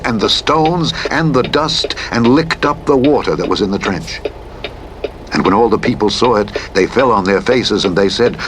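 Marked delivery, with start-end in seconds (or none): none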